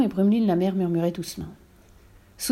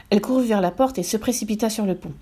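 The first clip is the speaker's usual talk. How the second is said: ordinary delivery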